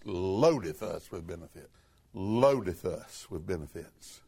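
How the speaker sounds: hushed repeat. Shown as a demonstration. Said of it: "What's the next word?".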